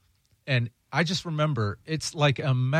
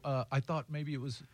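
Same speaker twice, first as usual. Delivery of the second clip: ordinary delivery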